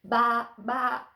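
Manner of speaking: loud imitated bleating; sheep baa. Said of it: "said the fat red hen".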